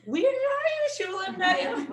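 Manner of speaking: high, slow sing-song calling; playful seeking call. Retold or imitated. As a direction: coax a hiding child out in a game. Mother playing hide and seek.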